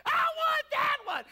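put-on high wailing child's cry, slow and loud; whining child. Little boy wailing at mom in the toy aisle, high and loud. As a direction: wail like a boy denied his toy.

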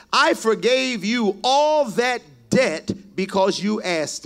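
loud, driving sermon reading of the parable; full-force preaching. Acted. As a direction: preach it out to the whole church. Preacher reads the parable aloud.

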